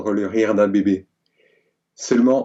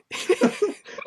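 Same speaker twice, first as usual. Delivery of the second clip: breathy laugh; 4 dB quieter than usual. Real, not acted. Laughs at her own story.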